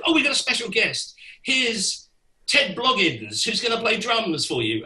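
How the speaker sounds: excited announcer. Retold or imitated. Organizer announcing special guest.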